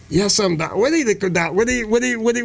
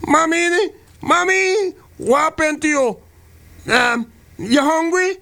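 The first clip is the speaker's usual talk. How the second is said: put-on child's voice; 7 semitones higher, slower than usual. Mother and son acted scene.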